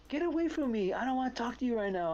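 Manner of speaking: high, hammed-up huffy brush-off; mock angry girlfriend. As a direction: imitate her storming off in a high voice. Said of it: "She goes".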